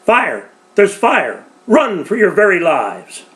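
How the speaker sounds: high, loud alarm call; a neighbour raising the alarm, shouting; shouted warning. Acted. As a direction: yell it down the street. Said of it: "a voice call out".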